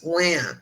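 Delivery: one drawn-out pretend wail; imitated crying. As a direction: mimic crying without real sobs. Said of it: "going like this".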